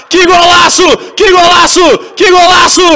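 high shouted goal celebration; explosive goal commentary. Real, not acted. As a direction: yell it out like the stadium just erupted.

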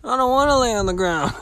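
high, fast whiny protest. Pretend. Vlogger answers the mock cop with a high, fast whine. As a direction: whine back like a scared kid.

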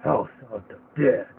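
loud, booming title read; spooky title voice. Acted. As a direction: announce it like a horror game. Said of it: "I nailed it with that voice".

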